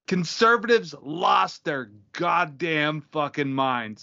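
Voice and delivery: normal talking voice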